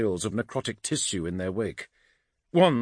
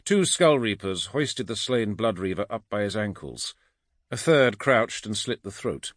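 plain reading voice

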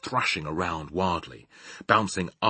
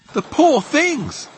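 high, loud pitying outcry; distressed cry; 7 dB louder, slower than usual. A narrator's reading. Sophie pities the whisked dreams, high distressed cry. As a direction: cry out slowly, pitched way up. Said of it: "Sophie cried".

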